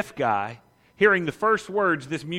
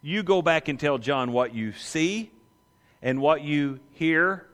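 slow, weighty instruction; Jesus sending the disciples back to John, slow and emphatic; emphatic command; 2 semitones higher, slower than usual. Acted. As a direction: send them back with slow firmness. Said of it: "It's an emphatic answer".

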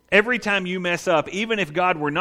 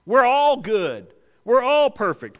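flattering; 6 semitones higher, 4 dB louder, slower than usual. Acted. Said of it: "how flattering he is".

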